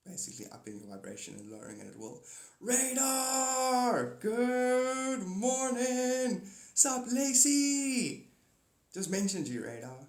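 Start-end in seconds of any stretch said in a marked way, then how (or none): 2.6-8.3 high, long sung-out hello; gleeful sing-song shout-out; drawn-out greeting call. Real, not acted. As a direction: call out loudly, stretching the vowels. Calling out greetings to arrivals.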